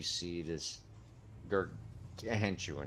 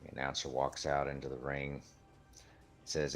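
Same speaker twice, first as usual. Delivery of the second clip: ordinary talk, nothing marked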